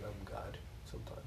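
soft breathy whisper. Real, not acted. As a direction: murmur it under your breath. Joking aside to friends.

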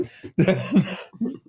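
high-pitched chuckle; real burst of high chuckling; laughter. Real, not acted. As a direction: laugh high at your own solution. Laughs at own solution.

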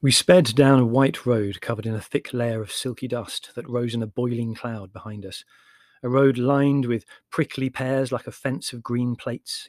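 ordinary delivery

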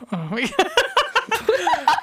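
laughing, drawn-out exclamation. Real, not acted.

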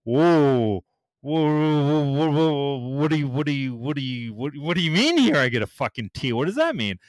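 stunned, stammering disbelief; retold disbelief. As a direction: sputter like you can't follow what's happening. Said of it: "And I'm like".

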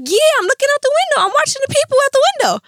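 put-on very high, fast, childlike chatter; giddy high voice. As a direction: sound giddy and dazed, all in a rush. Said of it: "I'm like".

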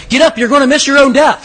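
a loud, mocking wake-up jab; sarcastic jab. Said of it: "somebody had something sarcastic in there".

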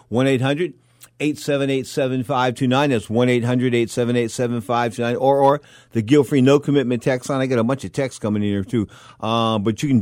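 ordinary delivery

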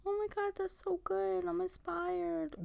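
soft, high mock praise; gushing fan. Imagined listener praising the podcast, soft high mock gushing. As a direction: imitate an admiring listener in a small high voice. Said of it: "we've never gotten any".

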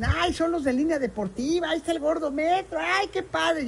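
high, breathy fan excitement; excited fans. Retold or imitated. Fans in the stands spotting the host, high and gushing. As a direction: gush like a thrilled fan spotting a star. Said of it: "y dicen".

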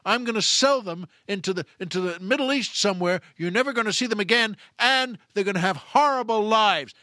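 acted sadistic bully losing his temper; madder and madder. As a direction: escalate from firm threat to angry shouting. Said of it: "make the guy madder and madder".

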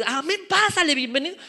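high-pitched, hearty mock greeting; hammed-up friendly greeter; equally friendly welcome. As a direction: welcome him just as eagerly. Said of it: "igual de amable con los dos".

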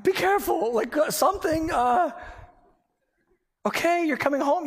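high, stammering mock worry; flustered worry. Husband on the phone to his wife in labor, flustered. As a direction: fumble anxiously for words, pitched high.

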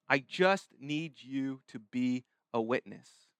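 low, slow, measured request; slow, deliberate. Acted. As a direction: space out "I just need you", softly and weightily. Repeats Jesus's request for emphasis.

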